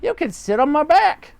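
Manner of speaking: high bird voice; roly-poly bird. Acted. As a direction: chirp it like a friendly bird. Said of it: "said the roly-poly bird".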